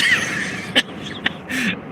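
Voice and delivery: soft, guilty chuckle; real laughter. Real, not acted. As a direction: laugh quietly though you know you shouldn't. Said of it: "I'm laughing about it".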